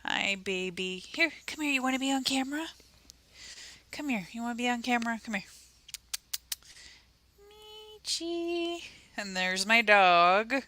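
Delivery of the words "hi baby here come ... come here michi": high, soft coo to a pet. Real, not acted. Owner coaxing her pet onto camera, high soft baby talk.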